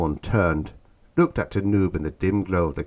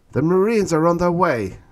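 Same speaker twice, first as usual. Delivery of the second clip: high, raised urgent report; urgent hiss; 11 semitones higher, 6 dB louder than usual. A narrator's reading. Stetson alerting Orn, urgent hiss. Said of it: "hissed Stetson".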